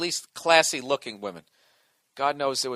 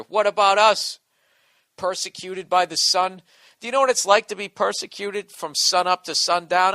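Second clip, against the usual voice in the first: loud, higher, indignant mock protest; mock outraged rant; 5 semitones higher, 7 dB louder than usual. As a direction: rant like a persecuted minority leader, hammed up. Mock rant for redheads' rights.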